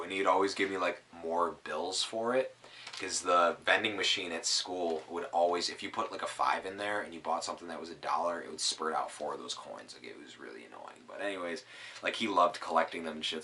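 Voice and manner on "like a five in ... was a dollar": ordinary delivery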